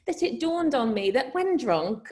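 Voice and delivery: ordinary delivery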